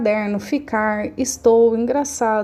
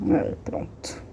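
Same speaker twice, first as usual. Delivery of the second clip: whispered aside; 7 dB quieter, slower than usual. Real, not acted. Aside while opening the pen.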